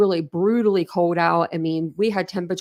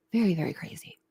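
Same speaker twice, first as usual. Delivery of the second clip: a quiet muttered echo to herself; hushed repeat; 7 dB quieter than usual. Real, not acted. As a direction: let it fall away under her breath. Repeating her point about crazy.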